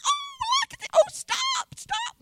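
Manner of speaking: very high, loud squeal of delight, retold; squealed, gushing excitement; excited squeal. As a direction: re-enact an overexcited sightseer squealing to stop. Retold delight at sights on trail.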